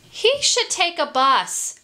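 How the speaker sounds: high storybook chicken voice; suggesting chicken. A narrator's reading. Chicken advising the farm animals, high suggestion. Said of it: "suggested the chicken".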